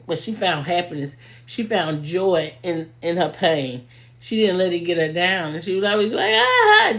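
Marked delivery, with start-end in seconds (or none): 6.3-6.9 high, loud burst of mock cry-laughing; imitated cry-laughing. Friend finding joy in her pain, imitated cry-laugh. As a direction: let out a big shrieked laugh, imitating a friend. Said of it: "just crying, laughing".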